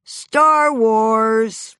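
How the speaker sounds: mock fight yell. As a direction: hurl the title like a shout between fighting parents. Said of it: "sounded like a fight between my original parents".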